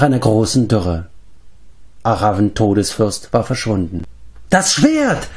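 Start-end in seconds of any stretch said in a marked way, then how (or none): 4.5-5.3 sharp high cry of alarm; excited cry. A narrator's reading. Fleiftur spots the sword and cries out to friends. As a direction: call out the discovery, pitch leaping up. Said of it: "schrie Fleiftur".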